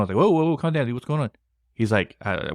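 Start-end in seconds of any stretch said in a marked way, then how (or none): 0.2-1.3 alarmed calming down. Retold or imitated. Friend calls about possession.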